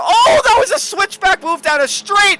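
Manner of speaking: very high, loud excited shout; shouted race call. Real, not acted. Commentator calling a near spin, shouted excitement. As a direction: explode at the switchback move.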